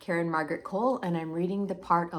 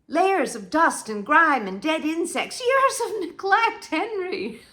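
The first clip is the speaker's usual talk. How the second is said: high, loud, mounting dismay; appalled reproach; 8 semitones higher, 6 dB louder than usual. Acted. Wife confronts Henry over years of neglect, appalled. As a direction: build to a drawn-out cry of disgust.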